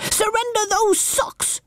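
put-on high brave child shout; little captain yell. A narrator's reading. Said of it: "yelled Baby Bumble".

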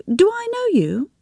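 high, puzzled woman's voice; a woman squinting at a half-familiar face. A narrator's reading. She asks the stranger on the beach, puzzled.

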